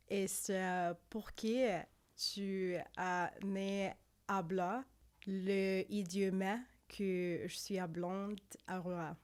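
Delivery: slow, halting Spanish in a French accent. Shown as a demonstration. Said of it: "trying to do Spanish with a French accent".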